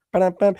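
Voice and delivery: high vocal drum sting; spoken punchline drum fill; mock rimshot. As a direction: sing out a comic drum hit. Mimics drum sting after joke.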